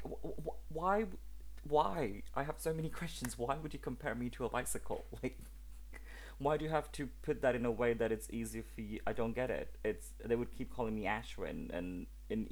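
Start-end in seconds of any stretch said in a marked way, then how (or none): 0.0-9.0 high, stammering mock bewilderment; high-pitched "why" in comic disbelief; baffled disbelief. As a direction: retell it as incredulous protest, half laughing. Retells baffled reply to bike comparison.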